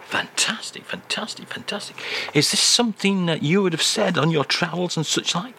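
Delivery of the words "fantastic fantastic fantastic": fading whisper. Acted.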